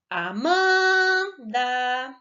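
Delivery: "Amanda" called out with MAN held long and loud; singsong call of "Amanda", long drawn MAN. Shown as a demonstration. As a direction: call "Amanda" as if to a friend at the door, stretching MAN. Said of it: "Nós vamos chamar as palavras".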